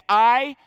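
"I" punched hard and loud; a loud emphatic "I". Shown as a demonstration. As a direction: hit "I" with full force. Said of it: "It's emphatic".

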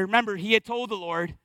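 normal talking voice

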